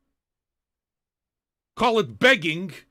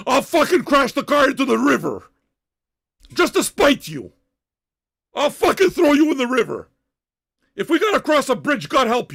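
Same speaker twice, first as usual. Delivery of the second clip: raised, higher-pitched threat; angry yelling; 4 semitones higher, 4 dB louder than usual. Real, not acted. Host threatening passenger on airport drive, furious yelling. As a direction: threaten them in a loud rage. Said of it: "why I'm yelling right now".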